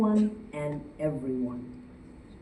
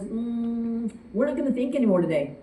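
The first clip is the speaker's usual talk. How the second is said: voicing her brain; 6 semitones higher than usual. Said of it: "and my brain says".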